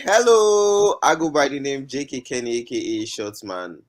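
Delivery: ordinary talk, nothing marked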